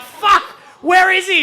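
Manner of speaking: loud, high-pitched panic; freaking out. Acted. Friend searching the beach for his buried mate, panicked shout. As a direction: shout it high, frantic with worry. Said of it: "We're freaking out".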